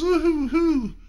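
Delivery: high sarcastic woo-hoo-hoo; a teasing mock shiver; mock scared woo-hoo-hoo. Mock fearful noise.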